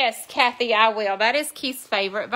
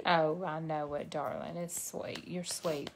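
soft comforting; 4 semitones lower, 12 dB quieter than usual. Real, not acted. Comforting crying daughter.

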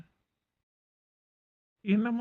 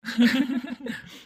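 loud laugh; 5 semitones higher, 5 dB louder than usual. Acted. Laughs at her surprise lie.

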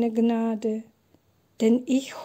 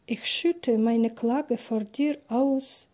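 ordinary talk, nothing marked

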